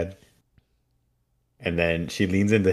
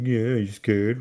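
raised-pitch teasing question; put-on playground jeer; mocking taunt; 5 semitones higher, slower than usual. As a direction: tease like a kid joining in. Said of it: "make fun of David train".

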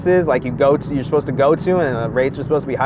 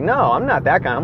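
usual speaking voice

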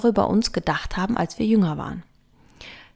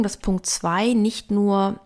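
usual speaking voice

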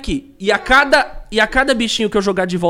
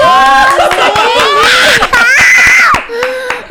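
excited laughing shout; 10 dB louder than usual. Real, not acted. Host opening the live stream.

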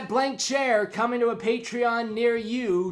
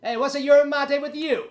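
louder, higher jokey pun on yerba mate; corny pun; 6 semitones higher, 6 dB louder, faster than usual. Pretend. Host cracks a Jungle Cruise style pun, louder and higher. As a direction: land the pun like a theme-park guide. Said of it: "after that joke".

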